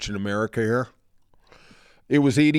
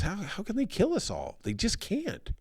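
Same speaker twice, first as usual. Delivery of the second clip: higher, softer voice of disbelief; disbelieving plea; 6 semitones higher, 6 dB quieter than usual. Retold or imitated. Prisoner on the train insists they can't kill everyone, pleading. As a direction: plead that it cannot happen, quietly. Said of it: "one of them looks at him and goes".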